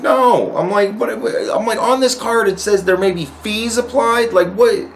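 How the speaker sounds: high, exasperated complaint; arguing with the clerk about fees, pitched high; retold indignation. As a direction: argue it with rising disbelief. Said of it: "and I'm like".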